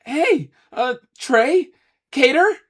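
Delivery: high, rising pleading call. Acted. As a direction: beg them to help. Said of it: "Ace entreated".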